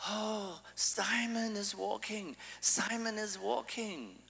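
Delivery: high, drawn-out jubilant cry; imitated parents' scream of delight; screaming excited parents. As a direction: crow it like thrilled parents, high and slow. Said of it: "the mother starts screaming and the father starts screaming".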